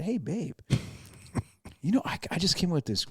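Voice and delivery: high, mock-sweet sarcasm; sarcastic. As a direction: pitch it up like a falsely helpful suggestion. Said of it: "I said it so sarcastic".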